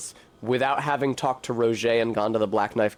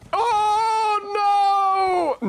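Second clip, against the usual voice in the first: high, drawn-out wail of dismay; long, high-pitched yell; dismayed cry; 4 dB louder than usual. Real, not acted. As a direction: cry out as it all goes wrong. Mishap while jumping on horse.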